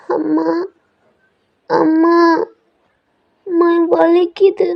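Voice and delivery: put-on high, whimpering little boy calling for mom; child crying in pain. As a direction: toddler wailing for his mother in pain. Hurt child calls mother.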